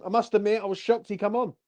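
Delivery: ordinary talk, nothing marked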